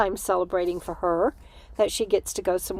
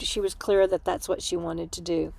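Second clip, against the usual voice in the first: normal talking voice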